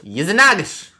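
"Yezinagosh" in one loud, high burst. Shown as a demonstration. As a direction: blurt out "Yezinagosh" whole. Repeats the name all at once.

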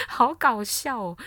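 can't hold back the laugh; laughing out the words. Real, not acted. Laughing at the story.

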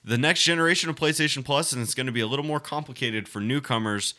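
reading the article aloud in a steady report voice; news article reading. Acted. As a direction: read it like a news piece.

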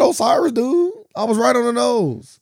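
high triumphant crowing, dragged out; excited gloating. Real, not acted. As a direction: crow about guessing the name right. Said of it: "I said his name".

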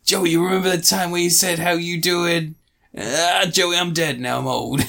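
put-on high-pitched old man act, fading to a dying end; mock old man. Old dying friend talking to Joey, hammed-up old man. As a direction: ham up an old friend reminiscing, then expiring. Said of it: "he's an old man".

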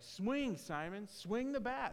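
high-pitched coach calling from the sideline; urgent coach. Retold or imitated. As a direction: urge the batter on, pitch jumping up on each swing. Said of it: "going after him from the side".

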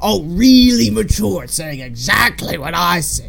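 exaggerated irritation at being copied; mock annoyed. Fan acts the Grinch annoyed with his echo, sarcastic.

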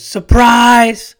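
high, loud burst of "surprise"; surprise-party shout. Retold or imitated. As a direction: yell it like a whole party crowd. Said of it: "everybody goes".

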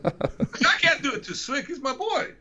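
high giggly voice, laughing through it. Real, not acted. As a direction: giggle while protesting. Laughing refusal to insult friend.

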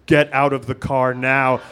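lightly imitated police megaphone command; a low, measured mock police order. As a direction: drop low like an officer on a loudhailer. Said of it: "we hear this police megaphone say".

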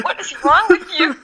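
high laughing mock question from classmates; mocking laughing quote. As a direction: laugh through the line as if baffled. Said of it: "They go".

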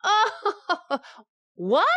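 high, loud laughing burst. Acted. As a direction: laugh at his mistake. Wife laughing at him.

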